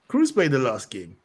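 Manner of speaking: ordinary talk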